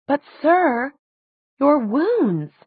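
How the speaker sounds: high-pitched, scolding protest; indignant nurse's objection. A narrator's reading. Nurse figure protests to her patient.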